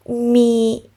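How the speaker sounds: ordinary delivery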